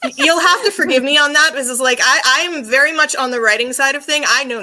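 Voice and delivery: talking through laughter; laughing. Real, not acted. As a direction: say it while laughing it off. Laughing apology about music.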